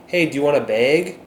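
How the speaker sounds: friendly Minnesotan cashier, "bag" like "beg"; Minnesotan accent. Shown as a demonstration. Vlogger playing a Minnesota cashier, light Minnesotan accent. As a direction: offer a bag like a Midwest cashier. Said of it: "That's how I grew up saying it".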